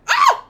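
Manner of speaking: sudden sharp yelp, very high and loud; a real cry of alarm at a blunder; startled yelp. Real, not acted. Streamer yelps on losing a rook, startled.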